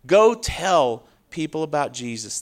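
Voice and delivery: ordinary talk, nothing marked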